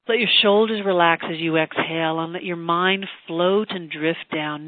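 usual speaking voice

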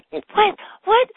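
high, loud stunned "What? What?"; shocked disbelief. Retold or imitated. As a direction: react as if slapped by the insult. Shocked reply to the insult.

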